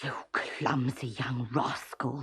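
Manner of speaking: low, slow angry shopkeeper. A narrator's reading. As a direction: growl the insult low and slow. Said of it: "he shouted".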